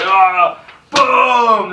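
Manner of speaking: loud, high burst of outrage; playing the angry official, shouting boom; mock shout. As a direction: slam it down with a shout. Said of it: "He goes like".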